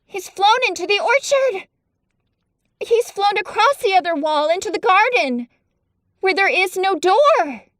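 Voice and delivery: calling out as the robin flies off; excited cry. Acted. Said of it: "Mary cried out".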